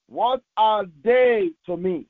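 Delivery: normal talking voice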